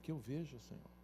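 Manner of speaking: the blind man quietly answering Jesus; hushed plea. Acted. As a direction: breathe the request.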